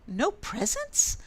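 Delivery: high, slow, dismayed rise; a mouse hearing there are no presents; shocked question. A narrator's reading. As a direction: stretch it out in disbelief.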